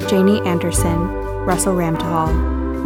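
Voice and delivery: ordinary talk, nothing marked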